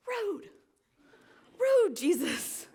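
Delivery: high, slow, hammed-up indignation; drawn-out teasing outrage; mock offended. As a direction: play scandalized at his words. Mock offense at Jesus' reply.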